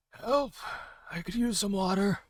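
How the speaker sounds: high, muffled cry from underneath. Acted. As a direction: call as if trapped under wood. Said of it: "You hear a very muffled voice".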